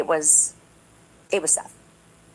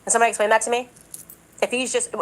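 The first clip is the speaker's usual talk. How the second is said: ordinary delivery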